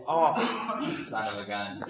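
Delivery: arm around them, soft and laughing; affectionate ribbing with a chuckle; gentle laughing son of a gun; friendly teasing. Shown as a demonstration. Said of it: "There's different ways of saying it".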